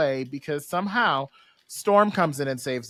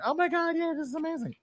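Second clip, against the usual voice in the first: tiny high squeal of delight; mock excitement; 11 semitones higher, 6 dB quieter than usual. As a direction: softly squeal it, very high-pitched. Voices regaining lost powers.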